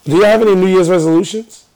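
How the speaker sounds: loud, sharp, high question. Real, not acted. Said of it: "Why are you yelling at me?".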